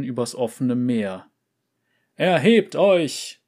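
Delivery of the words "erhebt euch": high, loud and slow; bellowing. Acted. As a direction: bellow it from the chest. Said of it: "brüllt er aus voller Brust".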